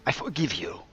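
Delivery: hammed-up Spanish accent. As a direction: play a gracious Spanish gentleman. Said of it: "a very forgiving Spanish gentleman".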